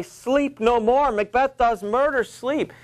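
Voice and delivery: slow, high phantom call; eerie voice crying out. Acted. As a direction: call it out like a haunting voice in the night. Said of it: "methought I heard a voice cry".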